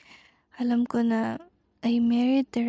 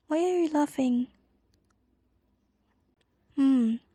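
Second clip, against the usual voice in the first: normal talking voice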